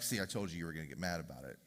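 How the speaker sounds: very low, soft, fast aside; muted told-you-so. Acted. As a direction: slip it in quickly under your breath. Wife's muted told-you-so after outburst.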